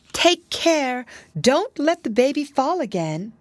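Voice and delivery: high-pitched, sharp cry of warning; urgent warning. A narrator's reading. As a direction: warn them suddenly, pitch leaping up. Sudden warning to nurse.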